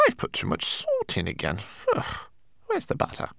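very soft grumble to oneself; muttering. A narrator's reading. Rat fussing over her salty soup, muttering softly. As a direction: grumble under your breath. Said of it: "she muttered".

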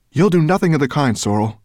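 loud, fast, firm rebuke; firm protective rebuke. Acted. Ben to Sorrel, loud fast rebuke. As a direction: stand between her and the little one. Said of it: "said Ben, putting his hand protectively".

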